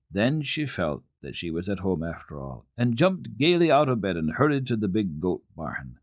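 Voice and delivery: plain reading voice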